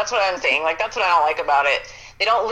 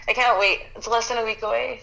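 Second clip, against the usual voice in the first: ordinary talk, nothing marked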